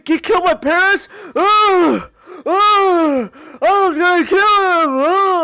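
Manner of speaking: high, loud, whining mock tantrum; mock Danny's tantrum like a whiny baby; hammed-up imitation of a bratty hero; whiny baby mockery. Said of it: "like a whiny little baby".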